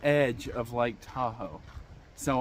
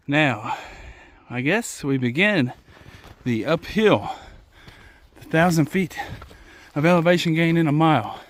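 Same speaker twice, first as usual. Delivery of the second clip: louder, pushing through the start of the climb; loud determined announcement; 8 dB louder than usual. Real, not acted. Hiker to viewers starting the climb, loud and fired up. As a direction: declare the thousand-foot climb with gusto.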